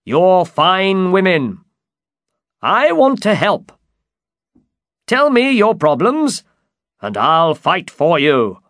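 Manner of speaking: high, loud, gallant knight; emphatic high-pitched storybook hero; Don Quixote. Acted. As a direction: proclaim your help to ladies. Don Quixote reassures the women.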